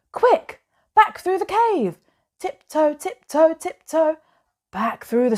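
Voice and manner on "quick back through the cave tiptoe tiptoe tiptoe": much higher, urgent flight; panicked hurry. Acted. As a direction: rush away from the bear breathless with fright. Fleeing the bear in panic.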